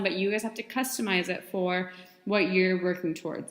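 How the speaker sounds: ordinary talk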